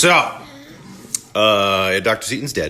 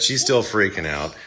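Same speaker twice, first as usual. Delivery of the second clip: ordinary delivery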